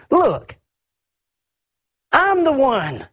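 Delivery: high, pleading mock voice of the older son; whiny older son. Older son manipulating his father, high mock whine. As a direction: wheedle like a spoiled son working his dad. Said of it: "and he says".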